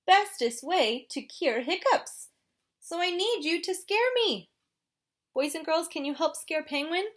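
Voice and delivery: hiccupy penguin asking for help; gasping little penguin; penguin character voice. Acted. Penguin asks kids to scare.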